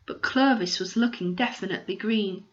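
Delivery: ordinary delivery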